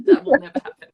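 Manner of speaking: high, loud, through real laughter; laughing. Real, not acted. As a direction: laugh your way through the words. Both laughing at own joke.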